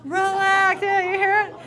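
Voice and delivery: loud, high mock order to relax; exasperated "relax". Man to an upset woman, loudly exasperated. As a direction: belt it at an upset partner.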